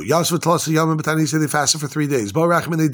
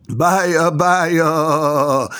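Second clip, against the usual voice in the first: long drawn-out wail, acted; moaning cry. Teacher voicing the people crying out over destruction, acted moan. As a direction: moan it out, holding the final vowel. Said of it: "They're crying out and they're moaning".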